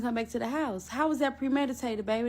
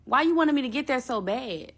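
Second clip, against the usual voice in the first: ordinary talk, nothing marked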